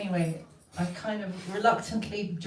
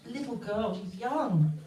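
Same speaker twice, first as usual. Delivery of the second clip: ordinary delivery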